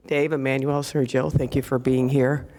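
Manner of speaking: ordinary talk, nothing marked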